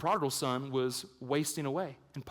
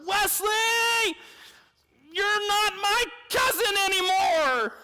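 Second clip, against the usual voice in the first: put-on very high, loud kid's yell; shrill hammed-up childish shout; child's shout; 5 dB louder than usual. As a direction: scream it like an outraged little boy. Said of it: "I just shouted at him".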